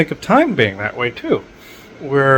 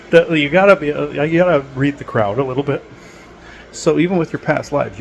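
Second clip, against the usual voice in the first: ordinary talk, nothing marked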